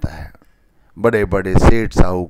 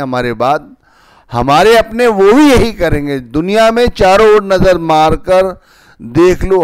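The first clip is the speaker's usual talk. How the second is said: raised preaching voice; wise mentor; 5 semitones higher, 4 dB louder than usual. Acted. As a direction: exhort the congregation, louder and higher.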